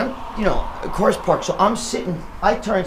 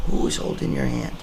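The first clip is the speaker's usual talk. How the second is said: hushed spooked question; 5 semitones lower, 3 dB quieter than usual. Retold or imitated. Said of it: "she goes to me".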